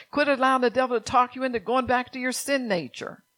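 ordinary talk, nothing marked

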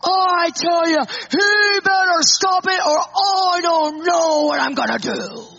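loud, high, strained angry outcry; angry threat. Retold or imitated. Husband threatening the emailer to his wife. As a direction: near tears with rage. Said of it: "he goes".

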